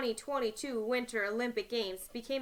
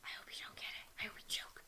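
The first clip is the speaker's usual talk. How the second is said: whisper; 18 dB quieter than usual. Real, not acted. Whispered aside during question.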